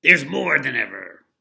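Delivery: loud gloating boss voice; mean boss. Acted. Laundry owner gloating at the washerwomen, loud. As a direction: gloat over the pile of work. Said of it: "he said".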